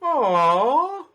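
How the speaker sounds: high cooing aww; goblin aww. Acted. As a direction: coo over the bunny in a high goblin voice. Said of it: "Chuckle Nuts behind Snogwallop go".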